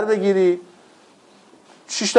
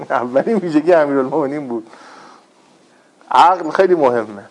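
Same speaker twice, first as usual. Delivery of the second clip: ordinary talk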